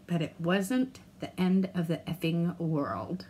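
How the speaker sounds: slow, hushed reassurance; whisper. Real, not acted.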